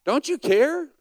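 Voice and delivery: high, loud accusing yell. Acted. Martha yelling at Jesus, high and loud. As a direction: throw it at him as a yell. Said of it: "she's yelling at him".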